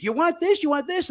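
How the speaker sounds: high mock yell of each ingredient. Woman yelling ingredients across the shop, mock yell. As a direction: imitate her hollering ingredients. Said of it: "she's yelling every ingredient".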